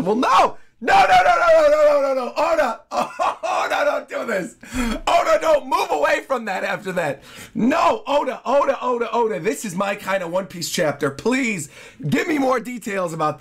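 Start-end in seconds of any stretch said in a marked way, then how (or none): 0.0-2.3 loud, high disbelieving shout; real shouted protest at the page. Real, not acted. Reacting in disbelief to the chapter. 2.4-9.4 higher, half-laughing plea; laughing plea to the mangaka; pleading with Oda. Real, not acted.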